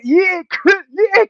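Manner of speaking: very high, loud greeting; real hyped shout-out; excited squeal. Real, not acted. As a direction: squeal the viewer's name in delight. Greeting a chat viewer.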